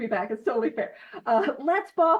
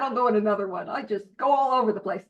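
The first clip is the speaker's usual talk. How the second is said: normal talking voice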